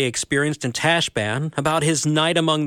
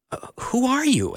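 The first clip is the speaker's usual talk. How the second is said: put-on higher boy's voice; 10 semitones higher than usual. A narrator's reading. Shasta asks fearfully.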